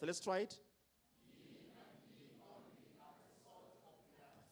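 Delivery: ordinary talk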